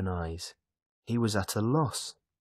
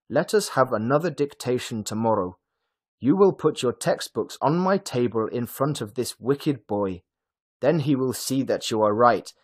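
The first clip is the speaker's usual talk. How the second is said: raised, indignant teacher addressing the class; higher, forceful classroom voice; stern teacher; 4 semitones higher, 5 dB louder than usual. A narrator's reading. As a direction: lay down the punishment to the whole class.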